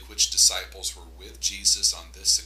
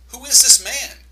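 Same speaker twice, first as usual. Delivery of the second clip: high, loud astonished cry; shocked disciples; 14 semitones higher, 11 dB louder than usual. A narrator's reading. Disciples in the boat, stunned and shocked. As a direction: gasp it out in disbelief.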